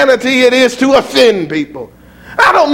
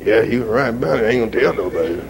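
low, soft, sheepish churchgoer agreeing; sheepish mumbled agreement; 13 semitones lower, 6 dB quieter, faster than usual. Retold or imitated. Preacher mocking his congregation, low sheepish mumble. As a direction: mumble it like a guilty pew-sitter.

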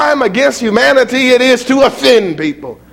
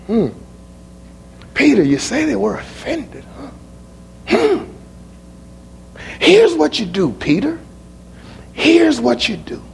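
softer, unhurried, steady; calm, quiet Jesus; 5 semitones lower, 4 dB quieter than usual. Acted. Jesus answering Peter, calm and quiet. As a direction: speak it calmly like a patient teacher.

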